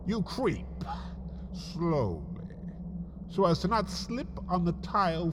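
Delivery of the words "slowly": plain reading voice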